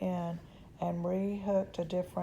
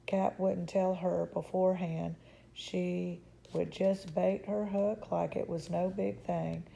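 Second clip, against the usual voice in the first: plain reading voice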